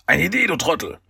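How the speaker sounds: high-pitched impatient retort; exasperated villain. A narrator's reading. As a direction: spell it out impatiently to a fool. Villain berates his slow henchman.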